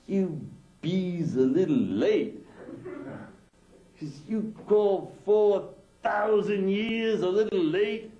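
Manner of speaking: mock the alien's words in high outrage; furious wife. Wife raging at the alien cosmonaut, high and slow.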